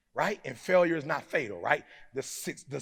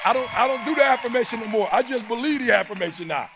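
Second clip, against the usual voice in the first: louder, raised preacher shout; rising preacher shout; 9 semitones higher, 9 dB louder than usual. Real, not acted. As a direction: lift it into a shout for the crowd.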